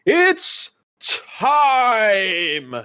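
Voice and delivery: high, slow, booming ring announcer; magically amplified host calling the crowd; loud booming announcer. Acted. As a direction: boom it out to the whole crowd. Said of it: "loud, booming voice".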